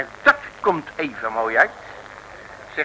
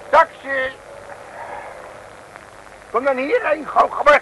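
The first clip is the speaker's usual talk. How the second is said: calling a taxi; 10 semitones higher than usual. Acted. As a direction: shout for the cab across the street.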